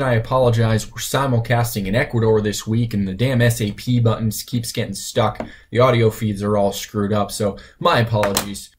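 usual speaking voice

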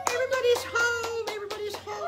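loud, very high squealed cheer; mamas' ecstatic welcome-home shout; joyful cheering. Acted. Mamas cheer the children's return.

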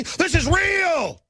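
high, slow yelled This is real; slow shouted insistence. Real, not acted.

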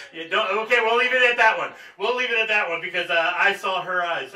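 loud, flustered backpedal, half laughing; flustered loud laughing retreat. Real, not acted. Pastor backing off loudly after a congregant's glare.

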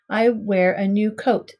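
ordinary delivery, nothing marked

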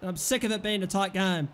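ordinary talk, nothing marked

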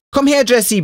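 called out high and bright across the room; calling across the room. A narrator's reading. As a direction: beckon her over from across the room. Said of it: "pfeift die Stimme von Jenny".

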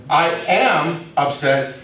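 "am" drawn out slowly in "I am upset"; stress on AM. Shown as a demonstration. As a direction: land firmly on "am", taking your time. Restates the real meaning.

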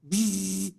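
imitated buzzing zap of a laser; a quick mock laser buzz. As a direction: buzz out like a zapping machine. Laser firing at ear hair.

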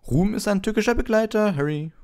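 preen over your fan mail; Lockhart voice. A narrator's reading. Lockhart boasts about a fan to Harry, high and pompous. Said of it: "fing er einen Satz auf".

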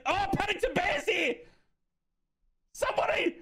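very high squealed cries breaking into laughter; laughing mock panic. Streamer finding Paddington Bear has turned up in chat, mock panic. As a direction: squeal in hammed-up alarm, half laughing.

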